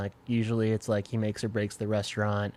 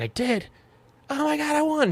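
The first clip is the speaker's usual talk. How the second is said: much higher, squealing with joy at winning; lotto winner excited. Retold or imitated. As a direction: mom overjoyed at finally winning, pitch soaring. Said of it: "lotto winner excited".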